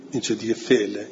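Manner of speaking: ordinary talk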